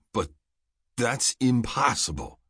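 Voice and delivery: drawn-out, mildly bewildered objection; bewildered exclamation. A narrator's reading. Rourke objects to being given the Lakaran job, bewildered. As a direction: protest slowly, in disbelief. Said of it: "Rourke's bewildered exclamation".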